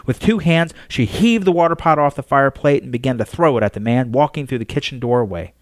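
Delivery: plain reading voice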